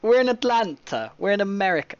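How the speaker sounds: loud, high, thrilled announcement; real excited yell; excited shout. Real, not acted. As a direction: cheer that you've finally made it to Atlanta. Excited arrival reaching Atlanta.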